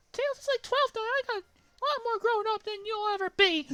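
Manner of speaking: put-on very high, loud squeaky girl shriek; Amy voice. Amy screeching at Sonic as she swings her hammer. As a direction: screech it like a furious cartoon girl. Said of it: "said Amy".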